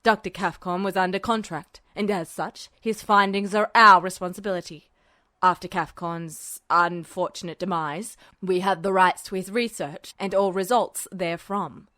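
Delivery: low, gravelly general; low, stern military man; gruff official. A narrator's reading. Tanaka voiced in story.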